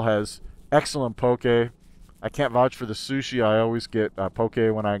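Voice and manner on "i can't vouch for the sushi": usual speaking voice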